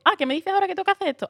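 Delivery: mock surprise. Actress imitating her carefree audition self, high, fast and playfully surprised. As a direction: toss it off like an eager beginner.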